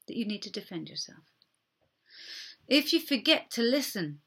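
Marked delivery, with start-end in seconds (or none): 0.1-1.2 soft, quick, sincere apology; hushed and contrite, acted out; gentle apology. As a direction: apologise softly, owning the hurt. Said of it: "So apologise".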